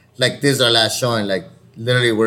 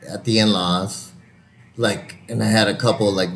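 ordinary talk, nothing marked

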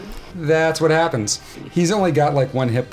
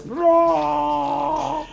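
gnoll roar. Acted. GM voices gnoll roaring.

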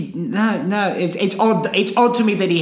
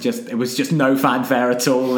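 ordinary talk, nothing marked

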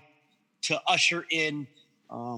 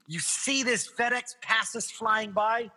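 ordinary talk, nothing marked

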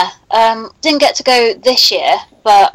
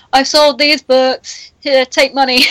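caveman-style voice; 5 semitones higher, slower than usual. Retold or imitated. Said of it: "be like".

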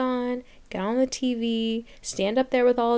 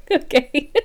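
laughing okay; 4 semitones higher, 5 dB louder than usual. Real, not acted. Laughing at own rant.

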